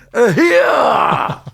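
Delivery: high, loud shout up at a window; yelling up. Retold or imitated. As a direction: holler his name up at him. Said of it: "we would all yell up at him".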